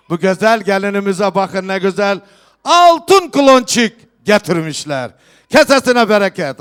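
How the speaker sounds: loud festive proclamation to the hall; turkish wedding announcer. Real, not acted. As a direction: call out each gift to the guests. Host proclaims gifts at wedding.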